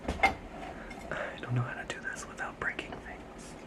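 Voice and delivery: unvoiced whisper while squeezing past plants; careful whisper. Real, not acted. Squeezing past fragile plants, whispering under her breath. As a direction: breathe the words so nothing breaks.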